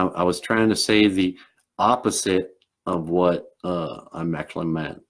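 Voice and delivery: put-on old-world Southern gentleman accent. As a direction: play a Southern dog character making a careful apology. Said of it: "he's got a Southern accent".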